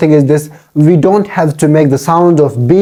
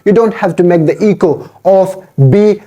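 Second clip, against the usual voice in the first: normal talking voice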